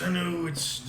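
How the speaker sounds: ordinary delivery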